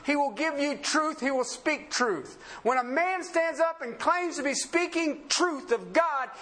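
slow, high-pitched emphatic pulpit proclamation; forceful preaching. Real, not acted. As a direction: drive each phrase home from the pulpit.

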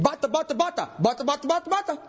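rapid-fire nonsense syllables, mimicking tongues; mock tongues. Preacher mimicking an angel nobody understands, mock tongues. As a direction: rattle off babble as if it were a language.

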